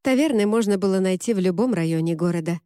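plain reading voice

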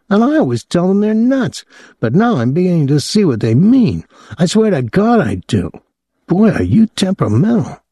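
put-on high, excitable young man's voice; a gawking kid, pitched high; young Claude, leering. A narrator's reading. Claude grumbling.

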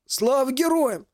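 slow, high rasping hail; hoarse veteran greeting. Acted. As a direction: call it out like a worn soldier. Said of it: "услышал Максим хриплый голос".

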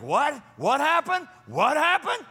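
very high, incredulous questioning; shocked disbelief. Acted. Everybody reacting to someone's crash and burn, shocked disbelief. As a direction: escalate into high-pitched shock. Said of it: "everybody's like".

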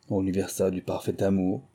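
ordinary delivery

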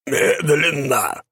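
slow, high croaking word; raspy cawing crow. A narrator's reading. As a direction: caw it out slowly. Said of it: "прокаркал голос".

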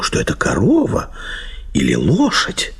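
normal talking voice

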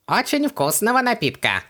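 high, gurgling little creature; gurgling genie voice. A narrator's reading. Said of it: "пробулькал Хубаксис".

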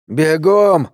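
shouted command. A narrator's reading. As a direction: call out to a companion to run. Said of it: "крикнул спутнику Ф". (крик-команда на повышенном тоне)

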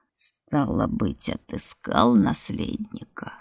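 very low, slow hollow voice; booming from a pit. Acted. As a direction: drone it up from deep in the ground. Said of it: "прогудел голос из ямы".

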